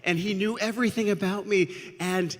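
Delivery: loud, high, frantic storybook cry; panicked warning. A narrator's reading. Sister runs up warning of a great serpent, panicked.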